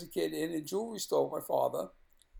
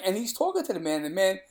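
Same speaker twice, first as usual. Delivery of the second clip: normal talking voice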